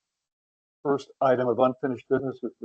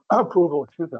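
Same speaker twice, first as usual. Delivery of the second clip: clearing throat mid-sentence; choking; 6 semitones higher, 3 dB louder, faster than usual. Real, not acted. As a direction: talk through a tickle in the throat. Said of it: "I'm choking on".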